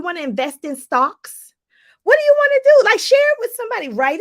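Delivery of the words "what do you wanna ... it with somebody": high, loud demand; rousing, high call out; excited challenge. Real, not acted. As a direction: shout the question at them.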